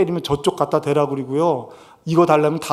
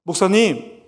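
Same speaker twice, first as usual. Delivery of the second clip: ordinary talk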